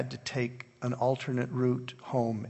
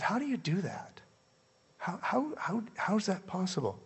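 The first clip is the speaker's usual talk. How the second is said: higher, softer, stunned asking; amazed question; 6 semitones higher, 5 dB quieter than usual. Retold or imitated. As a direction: ask in disbelief, voice lifting. Asks nun, amazed.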